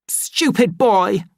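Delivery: slow, high, furious snap; angry shout. Acted. Linda shouts at her son after he hurts her, angry. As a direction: spit it at the child. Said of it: "she shouted".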